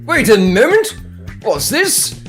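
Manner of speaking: ordinary talk, nothing marked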